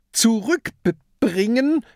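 slow, high rising zurückbringen?; sarcastic echo. A narrator's reading. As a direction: throw the word back with scorn. Peter repeats kraken's word mockingly.

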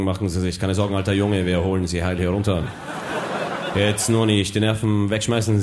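low, calm veteran pilot voice; erfahrener Pilot. Acted. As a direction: reassure like an old flight captain. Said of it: "Plötzlich andere Stimmen". (tiefe, ruhige Pilotenstimme)

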